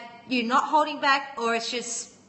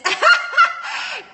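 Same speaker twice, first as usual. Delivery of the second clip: real high, loud burst of laughter; amused laugh; 7 dB louder than usual. Real, not acted. Amused by trolls in live comments.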